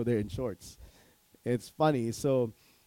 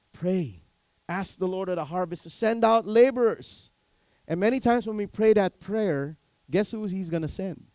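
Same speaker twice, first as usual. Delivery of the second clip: higher, louder preaching push; fervent preaching; 7 semitones higher, 8 dB louder than usual. Acted. Pastor urging congregation to pray, fervent preaching. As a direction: drive the call to prayer home.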